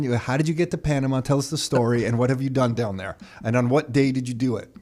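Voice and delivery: ordinary delivery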